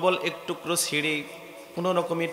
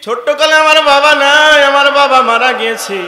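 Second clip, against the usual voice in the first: loud, high lament in a preacher's chant; tearful wail; 11 semitones higher, 13 dB louder than usual. Acted. Orphaned convert cries to the Prophet about his father, loud wailing lament. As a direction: wail it out, stretching the call. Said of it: "কান্না শুরু করল".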